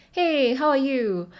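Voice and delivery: breezy Singlish hello to a friend; lighthearted Singlish greeting; Singlish accent. Shown as a demonstration. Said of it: "this is how I'll speak".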